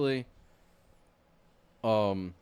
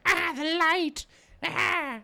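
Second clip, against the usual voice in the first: very high, slow monster screech; mock Shelob shriek; 5 dB louder, much slower than usual. Friend retelling the film, voicing Shelob as a shrieking monster. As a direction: shriek like a giant spider hit by light. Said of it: "she's like".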